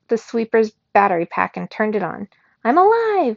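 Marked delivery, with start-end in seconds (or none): 2.6-3.4 high, loud machine waking up; a gadget blurting to life, pitched up; chirpy robot voice. A narrator's reading. Robot AI blurting awake to narrator, high and loud. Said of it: "it said".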